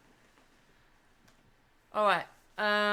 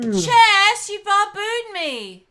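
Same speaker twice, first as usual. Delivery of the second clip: much higher, loud and slow accusation; outraged yell; 11 semitones higher, 13 dB louder, much slower than usual. Real, not acted. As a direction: shout the betrayal. Shocked at being beaten.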